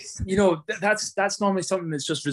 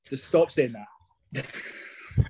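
exasperated drawl; much slower than usual. Real, not acted. Teasing plea to stop.

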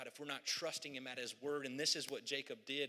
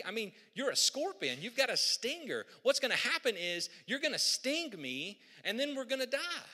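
high, loud, protesting frog voice; the frog panicking at the idea; alarmed frog; 10 semitones higher, 7 dB louder than usual. Acted. Frog refusing the scorpion, high and loud alarm.

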